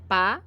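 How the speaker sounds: "pá" said alone as a separate syllable; "pá" on its own, held briefly; isolated syllable. Shown as a demonstration. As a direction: set "pá" apart as the first syllable. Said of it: "a primeira sílaba".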